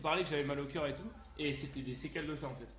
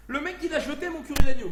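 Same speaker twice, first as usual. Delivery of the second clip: loud, high cry of indignation; outraged yell; 9 semitones higher, 18 dB louder than usual. Acted. Streamer tells viewers his curry was binned, outraged yell. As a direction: yell it in disbelief.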